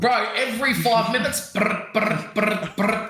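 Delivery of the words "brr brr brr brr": short repeated lip-trill bursts; imitated sputtering motor. Mocking a gassy classmate, imitated motor sputter. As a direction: imitate an engine failing to start. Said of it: "like he has a problem starting his fucking motor".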